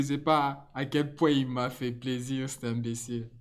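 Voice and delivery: usual speaking voice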